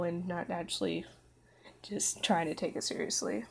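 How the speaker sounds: whispered trailing off. Real, not acted.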